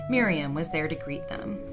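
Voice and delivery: ordinary delivery, nothing marked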